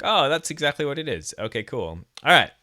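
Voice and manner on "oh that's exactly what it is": excited realization. Retold or imitated. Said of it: "I'm like".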